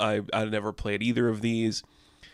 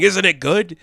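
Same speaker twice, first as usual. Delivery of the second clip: louder, higher mock prodding question; insistent boss; 8 semitones higher, 7 dB louder than usual. Boss forcing employee to try food, loud insistent question. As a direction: push the question out loud and high.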